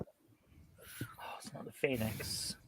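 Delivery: very soft, low near-whisper; under the breath. Real, not acted. Quiz player searching memory for a ship's name, under the breath. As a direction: think aloud, barely voiced. Thinking aloud, searching for an answer.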